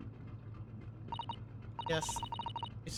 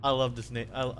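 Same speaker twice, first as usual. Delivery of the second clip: ordinary talk, nothing marked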